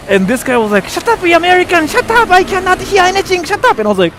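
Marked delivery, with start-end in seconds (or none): 0.9-3.8 high, loud imitation of a raging gamer; mock angry yelling. Guy shutting up the American on mic, mock angry yell. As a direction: yell like an irate teammate, very high.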